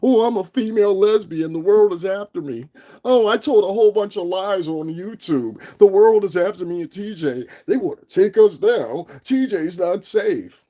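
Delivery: high, loud whiny mockery of a victim act; mocking her. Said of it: "like she tries to paint this".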